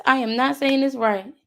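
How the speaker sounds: usual speaking voice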